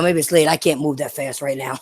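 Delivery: ordinary talk, nothing marked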